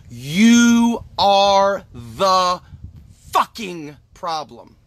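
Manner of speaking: slow, spaced-out emphasis; slow, deliberate. Shown as a demonstration. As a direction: spell it out word by word, furious. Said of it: "uh, slowly".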